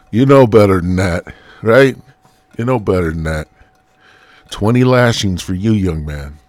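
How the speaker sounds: put-on God telling off a young man; God scolding. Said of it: "God's gonna be like".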